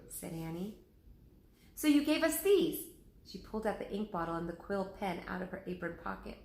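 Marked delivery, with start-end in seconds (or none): 1.7-2.8 put-on high, loud girl's voice; eager to show him; Annie's voice. Annie shows Lincoln the pen and ink: high eager girl's voice.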